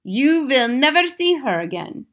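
put-on high, loud accented pronouncement; gloating Grand High Witch. A narrator's reading. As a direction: dismiss the victim with relish. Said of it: "said the Grand High Witch".

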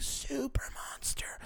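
hushed whispered aside, high and breathy. Pretend. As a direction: lean in and whisper it like a secret. Self-mocking reveal of looks.

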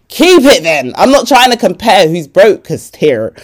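loud, playful protest; mock exasperated retort. To a friend competing over who's broke, loud exasperation. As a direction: push back loudly, half laughing.

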